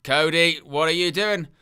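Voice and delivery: ordinary talk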